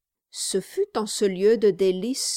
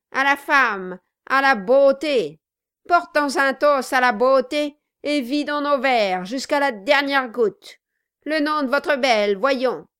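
loud, higher-pitched proclamation; boisterous toasting; 5 semitones higher, 6 dB louder than usual. A narrator's reading. Host toasting beauty to his guest, boisterously loud. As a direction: belt out the toast with gusto. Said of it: "cria-t-il en tambourinant".

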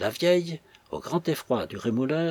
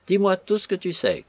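high, insistent demand; old hag's cry; 7 semitones higher, 4 dB louder than usual. A narrator's reading. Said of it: "cria la vieille sorcière". (cri pressant de vieille sorcière)

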